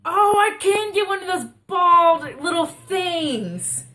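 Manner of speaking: high, loud and slow with delight; light up at the idea; excited. Real, not acted. Sudden idea for the costume.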